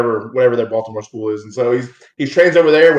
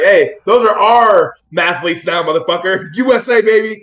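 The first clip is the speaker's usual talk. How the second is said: slow, louder, higher gloating patriot; mock proud American; 5 semitones higher, 5 dB louder than usual. America claiming immigrant mathletes, mock gloating patriot.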